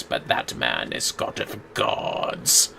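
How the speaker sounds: low, slow unvoiced whisper; mock reverent whisper; awed hush. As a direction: breathe it in reverent awe. Awed aside about character.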